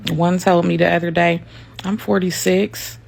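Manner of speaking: usual speaking voice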